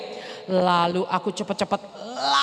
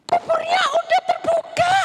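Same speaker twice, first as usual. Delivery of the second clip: high, loud shocked outcry; shocked shout, much higher; shocked cry; 14 semitones higher, 4 dB louder than usual. Acted. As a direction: cry out in alarm at the sight. Discovering the open tomb.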